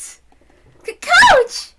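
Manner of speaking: a very high, loud startled call; surprised cry. Acted. Girl seeing the coach arrive, high surprised cry. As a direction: cry out in surprise at his entrance.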